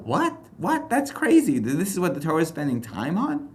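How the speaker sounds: squeaky-high incredulous "what?"; very high, comic astonishment; mock disbelief. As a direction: act out a dumbfounded reader, voice way up high. Acts out reader's disbelief at text.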